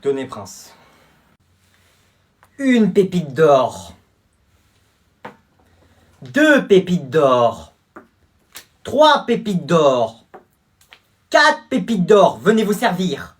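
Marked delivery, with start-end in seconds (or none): 2.6-12.4 each number drawn out, slow and louder; slow showy counting. Acted. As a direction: count the nuggets out slowly and proudly, like a show-off. Counting out gold nuggets proudly.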